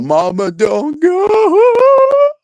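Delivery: high, loud, slow mocking wail; mocking Grace's song in a squealing falsetto. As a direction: howl it like a whiny child. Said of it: "Talking about".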